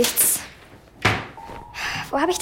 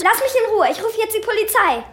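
panicked threat; 7 semitones higher than usual. Acted. Eileen threatening the stranger with police, panicked. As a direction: threaten him to keep him away.

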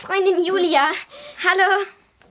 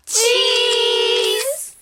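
sing-song cheese; 8 semitones higher, 5 dB louder than usual. Acted. Posing for a photo.

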